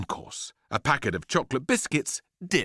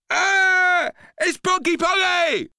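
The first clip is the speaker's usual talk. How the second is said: very high, loud shriek of revulsion; disgusted boy; 8 dB louder, slower than usual. A narrator's reading. Boy sniffs grapefruit, loud disgusted shriek. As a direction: shriek it like a revolted kid.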